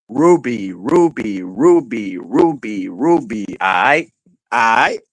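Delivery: low voice swooping on each repeat; Crack. Acted. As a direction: give each name the same slow rise and fall. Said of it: "Or Crack".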